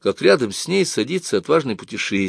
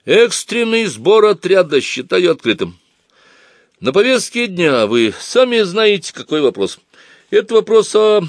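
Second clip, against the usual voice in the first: торжественно провозглашает; 10 semitones higher, 6 dB louder than usual. A narrator's reading. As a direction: open the meeting like a pompous chairman. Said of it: "уверенным громким голосом". (торжественно, громко, с расстановкой)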